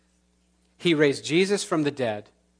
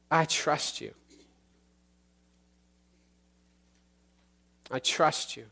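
earnest spoken prayer; 3 semitones higher than usual. Acted. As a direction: pray aloud for the whole congregation. Leads a prayer.

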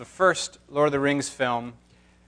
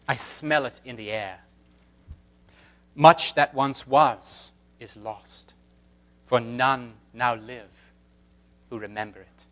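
put-on British accent; 3 semitones lower, 3 dB quieter than usual. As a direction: intone it gravely in a British accent. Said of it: "Should I do my British accent?".

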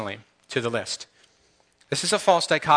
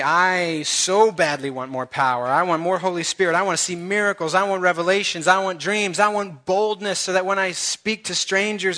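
pumped-up believer getting carried away; zealous declaration; 5 semitones higher than usual. Acted. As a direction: build from eager wanting to reckless, all-out zeal. Acting out a bold, zealous believer.

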